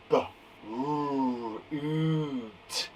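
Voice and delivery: B, r, oot said softly as separate sounds; teacher sounding out Brute for children to copy; segmenting sounds. Shown as a demonstration. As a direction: break Brute into its three sounds. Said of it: "Next one".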